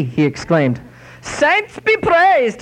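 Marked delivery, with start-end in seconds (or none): 1.2-2.6 put-on high, slow Irish old lady voice. Old lady praising the saints to the inspector, high Irish brogue. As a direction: stretch it out, much higher, like a pious old Irish woman.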